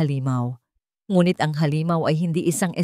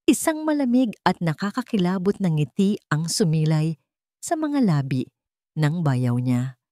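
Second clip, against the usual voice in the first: usual speaking voice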